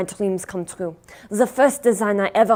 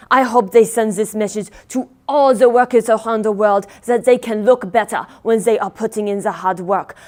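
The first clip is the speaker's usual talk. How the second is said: ordinary delivery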